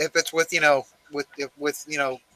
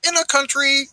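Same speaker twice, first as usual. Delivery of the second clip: mock documentary narrator; 4 semitones higher than usual. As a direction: narrate like a biopic.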